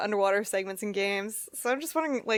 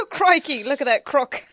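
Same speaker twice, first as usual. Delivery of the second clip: loud Aussie wildlife host; hammed-up Australian croc hunter; Australian accent; 5 semitones higher, 8 dB louder than usual. Mock nature host.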